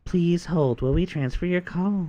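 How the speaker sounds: hold message voice. Shown as a demonstration. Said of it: "softer on the voice".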